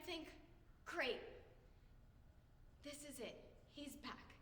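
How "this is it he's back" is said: very soft, private thought; hushed hope. Acted. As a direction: say it softly to oneself. Acted quote of her hopeful thought.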